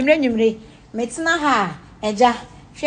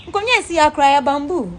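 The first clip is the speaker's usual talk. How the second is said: ordinary delivery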